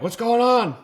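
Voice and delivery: high, loud mock panic; imitated freaking out. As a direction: mimic a frantic passenger, voice jumping way up. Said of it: "she's, like, freaking out".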